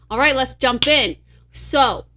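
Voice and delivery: lightly put-on big boy announcing. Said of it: "Did you put on your big boy voice there?".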